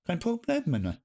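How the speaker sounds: chirpy mock-German repeat; bright sing-song "Problem"; playful German. Shown as a demonstration. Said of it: "German reply".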